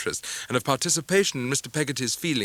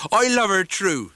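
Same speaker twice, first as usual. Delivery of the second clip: higher, louder heartfelt vow; earnest Ham; 7 semitones higher, 6 dB louder, slower than usual. A narrator's reading. Ham declaring love for Em'ly, earnest burst. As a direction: blurt it out with all his heart.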